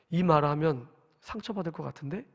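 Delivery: retold second thought, voice dropped; quiet inner thought. Preacher recalling the Spirit's prompting mid-scolding, quiet inner thought. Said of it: "그 마음을 주시는 거예요".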